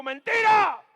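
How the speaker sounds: loud, high cry of mentira; shouted rebuke. Retold or imitated. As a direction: shut the lie down hard. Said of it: "Le digo".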